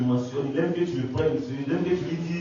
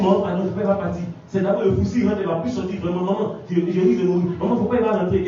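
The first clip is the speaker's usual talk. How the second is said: higher, louder begging cry; desperate pleading; 5 semitones higher, 5 dB louder than usual. Retold or imitated. Daughter clinging to her mother's foot, begging. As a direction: beg your mother not to go in. La fille attrape mon pied.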